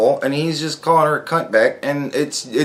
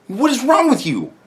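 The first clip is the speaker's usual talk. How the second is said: ordinary delivery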